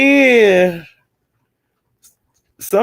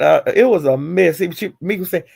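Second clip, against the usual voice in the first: ordinary talk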